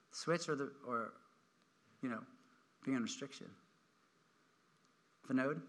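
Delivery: low, quiet dad with long pauses; reproving dad. Acted. Dad confronting a child who messed up, quietly reproving. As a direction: hold back and let the wrongdoer squirm, softly. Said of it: "Dad should be like this".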